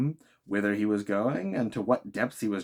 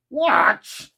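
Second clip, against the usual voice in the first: higher, loud scornful bark; exclaiming; 12 semitones higher, 9 dB louder than usual. Acted. Harpooner scoffs at the advice, loud exclamation. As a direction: fling the word back angrily. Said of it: "exclaimed the harpooner".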